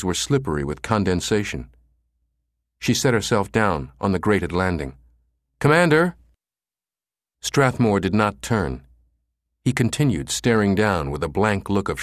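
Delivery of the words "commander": loud high call into the steam; urgent repeated call. A narrator's reading. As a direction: shout his name louder into the shaft. Susan calls again, no response.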